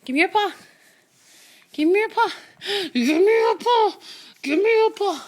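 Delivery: high, loud coaxing, building with laughter; excited coaxing. Real, not acted. Owner begging the dog for its paw, high and excited.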